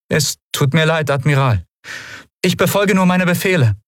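lieutenant with a hint of unease; apologize, a little unsure of herself; uncertain lieutenant. Lieutenant apologizing to the admiral, mildly unsure. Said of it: "Und dann unsicherer".

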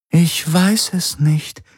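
a computer voice, sadly sincere; sincerely sorry. Acted. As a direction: admit helplessness gently and slowly. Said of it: "Sie klang ehrlich betrübt".

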